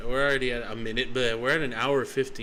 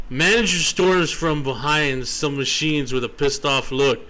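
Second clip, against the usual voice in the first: normal talking voice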